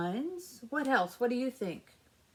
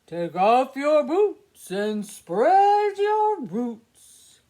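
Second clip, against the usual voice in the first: slow, much louder booming tree; 2 semitones higher, 12 dB louder, much slower than usual. Acted. Trees speaking to the lonely pumpkin, slow booming voice. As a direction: boom it out slowly like a kindly old tree. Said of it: "one finally spoke".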